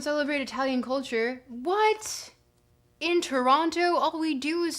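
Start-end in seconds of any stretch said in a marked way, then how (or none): 1.5-4.8 much higher, louder disbelieving what; indignant surprise. Real, not acted. Streamer rebuts chat about Italian culture, indignant. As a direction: act offended at the accusation.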